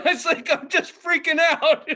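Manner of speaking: high, slow words breaking into laughter; laughing through it. Real, not acted. Storyteller recalls freaking out behind the comic, laughing helplessly. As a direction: crack up while telling your own embarrassment.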